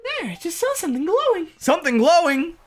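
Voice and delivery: high, loud, thrilled spotting; excited high voice. Acted. Excited character spotting glow.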